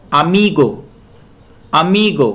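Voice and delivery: Amigo with मी loud, गो falling; stress on middle syllable. Shown as a demonstration. Teacher gives the rule's example, stressing मी in amigo. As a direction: punch the मी of amigo. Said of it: "एक syllable पहले पे stress".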